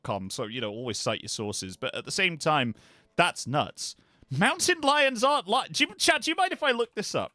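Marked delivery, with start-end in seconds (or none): 4.3-7.3 can't wait to look it up; excited and high. Real, not acted. Excited about search.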